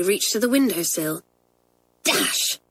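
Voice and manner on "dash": unvoiced whispered oath; hushed curse. A narrator's reading. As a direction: breathe it out under your breath. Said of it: "said Janet".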